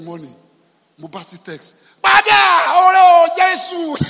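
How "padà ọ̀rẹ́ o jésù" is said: very high, full-blast comic scream; loud outcry. Acted. Pastor acting out his shock at the text, screamed outcry. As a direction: cry out at the top of your voice.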